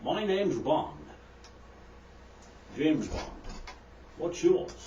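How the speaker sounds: lightly voiced suave gentleman spy; understated spy introduction, measured and spaced out; James Bond. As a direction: pause deliberately between each short phrase. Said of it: "the man looks at the chicken and says".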